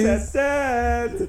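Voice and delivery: mocking high voice. Mocking impression of onlooker.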